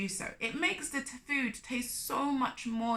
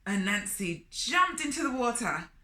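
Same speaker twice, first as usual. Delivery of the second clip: plain reading voice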